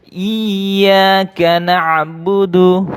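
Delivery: slow chanted tilawah; Quran recitation. Shown as a demonstration. Teacher chants the verse for pupils to repeat. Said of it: "Ikuti Pak Guru".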